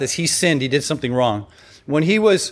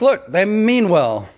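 mock excuse; 6 semitones higher, slower than usual. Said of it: "He's like".

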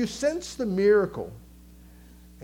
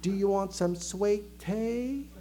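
slow, softer Southern drawl; slow Southern accent; 5 dB quieter, slower than usual. Acted. Preacher imitating a slow Southerner offering tea, Southern drawl. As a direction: draw it out in a mock Southern accent. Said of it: "I can say sweet tea faster than they can".

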